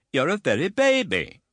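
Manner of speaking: put-on high, imperious old lady voice; stern aunt. A narrator's reading. Stern aunt sizing up the widow, high and imperious. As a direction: look down on her from a height. Miss Betsy speaking.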